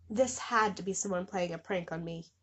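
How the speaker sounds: ordinary talk, nothing marked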